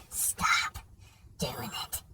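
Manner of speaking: unvoiced hushed warning; whisper. Warning his buddy under his breath, whispered. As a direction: hiss it under your breath.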